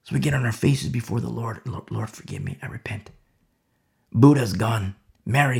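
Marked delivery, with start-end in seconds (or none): 1.7-3.1 hushed prayer. Acted. Preacher acts a repentant prayer.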